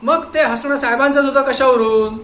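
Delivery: loud, high interrogator's voice; stern questioning. Acted. Inspector grilling the watchman, loud and stern. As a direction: press him hard like a cop.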